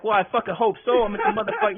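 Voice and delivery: loud, high-pitched mock exasperation; exasperated retort. Patient answering the overexcited doctor, loudly exasperated. Retold incredulous reply to doctor.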